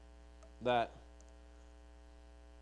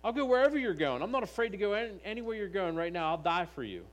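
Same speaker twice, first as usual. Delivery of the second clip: Peter's promise, much higher and louder, pleading; eager Peter; 6 semitones higher, 3 dB louder than usual. Acted. Peter vows to Jesus he'll follow anywhere, high and fervent. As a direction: Peter insisting, all fervour, higher pitched. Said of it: "Peter right here saying".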